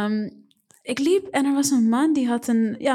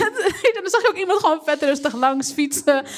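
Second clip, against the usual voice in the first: usual speaking voice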